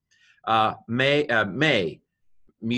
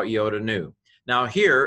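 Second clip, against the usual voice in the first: normal talking voice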